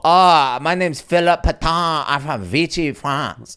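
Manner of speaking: put-on high, loud, garbled French accent; bad French accent. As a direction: overblown Frenchman introducing himself, mangling the words. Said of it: "sound like you have a speech impediment".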